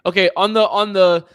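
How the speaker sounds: loud repeated okays, fired up; loud excited. Real, not acted. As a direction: shout it out, hyped.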